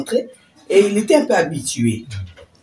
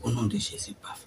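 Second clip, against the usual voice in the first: hushed prayer; 6 semitones lower, 6 dB quieter than usual. Retold or imitated. Prière sur le lézard.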